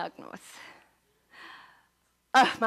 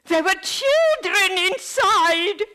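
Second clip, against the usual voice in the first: slow, louder, voice breaking; shocked by what she found; crying out; 7 semitones higher, 8 dB louder, slower than usual. Acted. Finding starving children, cry heard.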